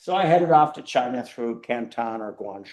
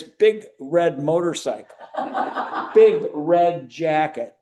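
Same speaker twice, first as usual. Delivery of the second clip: laughing; 4 semitones higher, 4 dB louder, slower than usual. Real, not acted. Amused describing friend's outfit.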